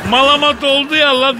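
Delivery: put-on high, laughing Tokat-accented young man. Brutus tells Kadir about Caesar in a high, laughing Tokat accent. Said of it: "Tokat şivesini kapmış".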